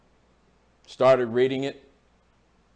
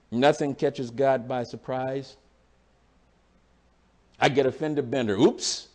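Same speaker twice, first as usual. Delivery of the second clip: ordinary talk, nothing marked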